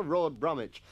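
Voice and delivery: usual speaking voice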